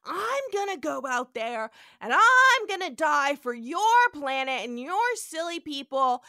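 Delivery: loud, high grandstanding Kirk; hammed-up heroic speech. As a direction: declaim it like a self-sacrificing hero. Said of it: "He's like".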